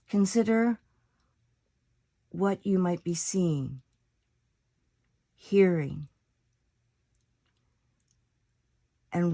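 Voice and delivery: calm meditation voice with long pauses between phrases; guided meditation. Acted. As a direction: lead them unhurriedly into stillness, letting each phrase settle.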